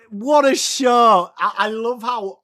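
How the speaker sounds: slow, loud and high emphatic praise; raving. Real, not acted. Streamer praising a show to chat, slow emphatic rave. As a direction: lean on every word in admiration.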